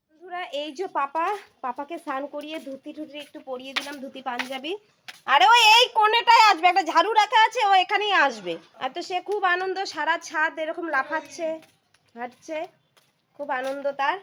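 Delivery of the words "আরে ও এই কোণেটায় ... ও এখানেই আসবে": loud, high outburst; raised, high-pitched voice; exasperated exclamation. Real, not acted. Exclaiming as child heads for broom.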